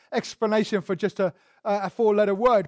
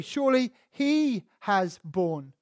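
HE drawn out slowly before "has borne"; slow right down and lean on "he"; long, weighty HE; stress on HE; slower than usual. Shown as a demonstration. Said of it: "That's the emphasis".

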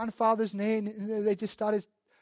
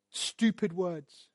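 slow deadpan gloss; much slower than usual. Real, not acted.

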